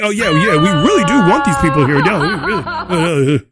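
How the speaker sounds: hammed-up tearful wail with wobbling pitch; mocking sobbed protest; mock crying. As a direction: blubber it like a fake crybaby. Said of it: "they're all boo-hooing and crying".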